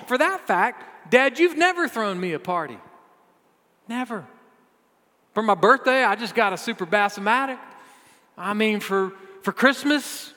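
high, loud, aggrieved rant; hammed-up whining complaint to dad; indignant older son. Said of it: "He sounds super happy that his brother's home".

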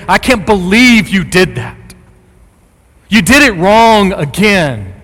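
loud, high-pitched harsh reproach; scolding condemnation. Acted. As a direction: berate them like a disappointed father. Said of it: "Jesus does not talk like that".

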